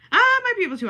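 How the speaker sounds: high, loud burst of excitement; loud high-pitched realization; excited squeal. Real, not acted. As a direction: squeal with sudden hope. Suddenly finds she can do it.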